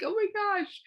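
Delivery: high-pitched appalled gasp of words; high, mock-horrified reaction; retold shock. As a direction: exclaim it high, aghast at the dog. Retold shock at dog biting aunt.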